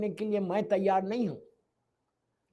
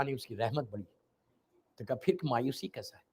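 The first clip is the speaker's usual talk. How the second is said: much softer and lower, almost murmured; hushed answer; 9 semitones lower, 10 dB quieter than usual. Acted. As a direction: drop to a hush for the answer. Quiet reflective reply.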